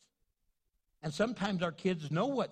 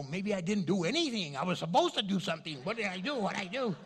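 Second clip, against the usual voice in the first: fretting; 4 dB louder, faster than usual. Retold or imitated. Fretting, speeding up.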